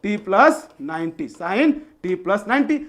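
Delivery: very slow, each word set apart for note-taking; slow dictation. Shown as a demonstration. Teacher dictating the formula to students, very slow. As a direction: dictate it slowly so students can write. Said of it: "ध्यान से लिखिएगा".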